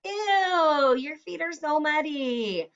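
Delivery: high, grossed-out reaction; disgusted ew. Shown as a demonstration. Teacher acting disgusted at the dog's muddy feet. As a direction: squeal it like you just saw muddy paws. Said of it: "I could say, like".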